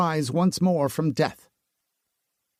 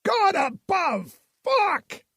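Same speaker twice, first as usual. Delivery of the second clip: high screamed curses, the first words slow; screaming; much slower than usual. A narrator's reading. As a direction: keep screaming in horror. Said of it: "He kept screaming".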